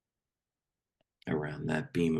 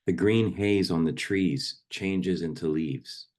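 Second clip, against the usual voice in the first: poem read aloud in measured, fuller voice; firmly projected poetry reading; poem recitation; 4 dB louder than usual. Acted. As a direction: recite it line by line, letting phrases fall at the ends. Recites a poem to a meditation group.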